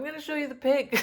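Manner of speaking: high and quick, eager; excited. Real, not acted. Vlogger eager to show viewers her pig, quick and excited. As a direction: rush ahead, can't wait to show it.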